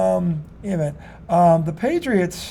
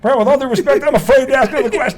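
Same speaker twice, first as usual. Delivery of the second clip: laughing mock fear; 6 semitones higher than usual. Mock scared reporter, laughing.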